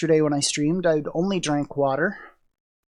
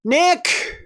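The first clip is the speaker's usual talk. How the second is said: calling out; 10 semitones higher than usual. Real, not acted. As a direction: call out for Nick across the room. Calling out to Nick, sick.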